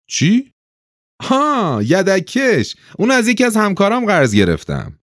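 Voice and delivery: very high, put-on character voice; high-voiced neighbor. A narrator's reading. Narrator voices Patrick answering Ove. (صدای نمایشی خیلی زیر)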